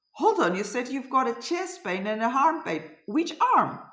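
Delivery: alarmed mother cutting in; urgent alarm. Retold or imitated. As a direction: demand an answer, pitch leaping on the question. Sudden alarm, urgently questioning daughter by phone.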